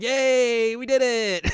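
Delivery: high, loud mock cheer; imitated group hooray; celebrating. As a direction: cheer like a jubilant team. Team cheering her success, retold.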